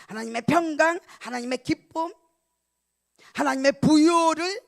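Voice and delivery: preaching at full force, loud and ringing; fervent preaching. Acted. (힘찬 설교조, 점점 높고 크게)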